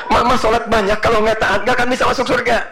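half-teasing husband lecturing his wife; mock husband preaching. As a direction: pretend to be the smug husband scolding.

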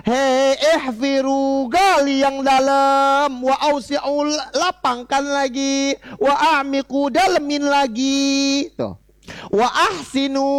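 high slow commanding call to the diggers; high drawn-out instructions at the graveside; commanding gravediggers. Acted. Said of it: "Kata Rasulullah".

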